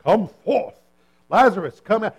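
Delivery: loud commanding call; authoritative command. Retold or imitated. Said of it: "It just sounds so authoritative".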